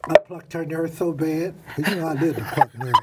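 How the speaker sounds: ordinary talk, nothing marked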